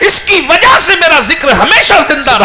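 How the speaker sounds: Amina's words proclaimed in a fervent sermon cry; soaring preaching cry. Acted. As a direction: lift the mother's words to a triumphant shout. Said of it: "لیکن کہتی ہے".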